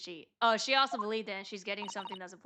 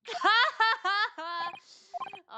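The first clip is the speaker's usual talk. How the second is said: a sudden high burst of laughter; 11 semitones higher, 5 dB louder than usual. Real, not acted. Player laughs at a game line.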